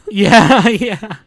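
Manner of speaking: loud, high yeah through laughter; laughing agreement. Real, not acted. Host amused by friend's crab-on-the-beach remark, laughing agreement. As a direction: agree while cracking up.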